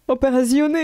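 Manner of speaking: opérazione with a fake foreign accent; stumbling fake German accent on opérazione; German accent attempt. Shown as a demonstration. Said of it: "je sais pas comment dire en allemand".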